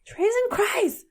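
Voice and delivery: high, slow mock-angry exclamation. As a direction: act out a pretend curse in anger. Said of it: "what you say when you're angry".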